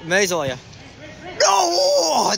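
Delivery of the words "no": high, loud cry of dismay; anguished shout. Real, not acted. Player watching a chance slip away, anguished shout.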